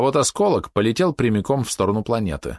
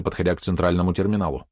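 plain reading voice

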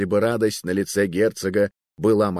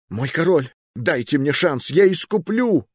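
high, rising desperate plea; pleading youth; 7 semitones higher than usual. Acted. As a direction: beg for one chance. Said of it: "возразил было юнец".

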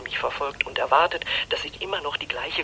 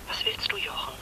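hushed whisper; 4 dB quieter than usual. Acted. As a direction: whisper so no one hears. Secret phone call, whispered.

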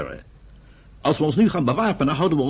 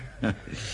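short soft laugh; 6 semitones lower, 9 dB quieter than usual. Acted. Brothers' awkward reunion.

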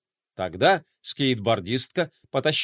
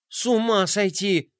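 exasperated exclamation; 6 semitones higher than usual. Acted. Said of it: "воскликнула Глория".

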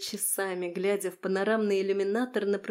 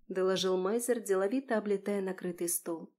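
ordinary delivery

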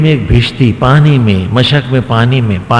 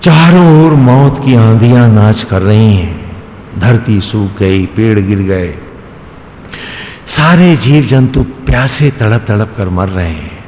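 normal talking voice

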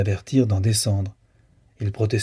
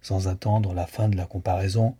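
plain reading voice

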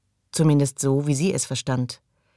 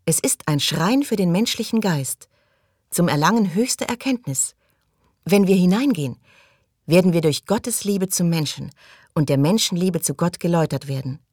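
fervent devotee Maggie; 3 semitones higher than usual. Acted. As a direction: proclaim it with pious fervour, pitched up. Maggie schwärmt vom Schrein. (schwärmerisch verkündend, mit höherer Stimme)

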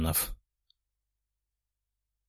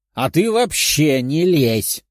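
drawn-out, mildly raised telling-off; snapped cry; 2 semitones higher, 3 dB louder, slower than usual. A narrator's reading. Two quarreling girls turn on Liza, indignant cry. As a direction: tell the meddler off, stretching the words. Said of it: "одновременно крикнули огненные девушки".

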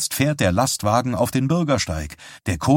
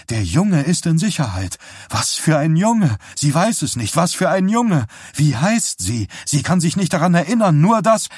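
rising panic; 8 semitones higher, 4 dB louder than usual. A narrator's reading. Trance voice grows frantic.

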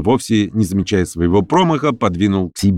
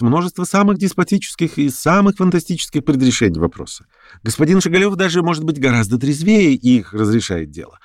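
lightly voiced debater, higher-pitched; argumentative lame man; 7 semitones higher than usual. Lame man defends Shigalyov's theory, argumentative. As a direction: argue it insistently, pitch lifting on the points.

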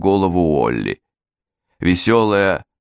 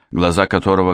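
usual speaking voice

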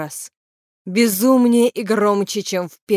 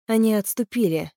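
ordinary delivery, nothing marked

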